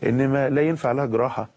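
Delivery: normal talking voice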